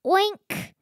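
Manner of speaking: high, squeaky little oink; piglet oink. Acted. Reading the farm story aloud, piglet oinks high and squeaky.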